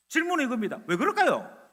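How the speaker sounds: ordinary talk, nothing marked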